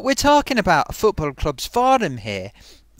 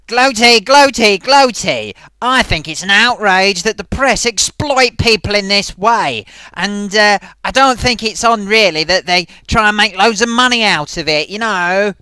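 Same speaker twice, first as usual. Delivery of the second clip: loud theatrical put-on voice; theatrical puppet rant; 7 dB louder than usual. Pompous puppet lectures Gloaty on the press, loud theatrical voice. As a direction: declaim like a pompous old actor.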